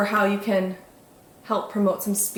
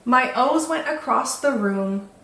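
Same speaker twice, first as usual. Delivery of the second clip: slow, sing-song picture-book narration; children's story reading; 2 semitones higher, slower than usual. A narrator's reading. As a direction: read it to little ones, savouring each rhyme.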